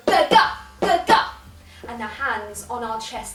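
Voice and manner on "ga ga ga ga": loud, explosive ga ga; punched syllables. Shown as a demonstration. Punching air and stamping, explosive shouts. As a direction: fire out each pair like a punch. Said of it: "punch the air and stamp our foot".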